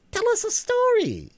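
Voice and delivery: shrieky Hare demanding a story. A narrator's reading. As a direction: insist like a bossy creature.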